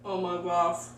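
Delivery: talking through a mouthful; mouth full. Real, not acted. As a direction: say it while chewing. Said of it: "talking with my mouth full".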